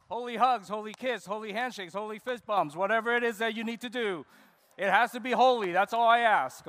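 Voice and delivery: loud calling out. Real, not acted. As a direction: call it out like a cheerleader. Calling the congregation to greet.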